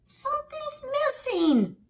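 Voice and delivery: squeaky little hare noticing; high hare voice, puzzled. Acted. Hare speaks.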